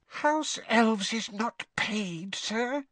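very high, slow squeak behind her hands; shocked, hiding her face; muffled squeak. Acted. Winky horrified at the idea of pay, muffled squeak. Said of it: "she said in a muffled squeak".

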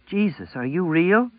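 high, shaky, slow and scared; stunned girl, voice trembling. A narrator's reading. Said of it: "she asked in a trembling voice".

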